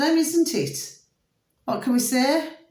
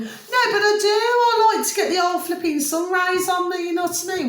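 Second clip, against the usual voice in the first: high, giggling insistence; laughing; 8 semitones higher than usual. Real, not acted. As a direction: laugh through the words. Laughing about sunbathing.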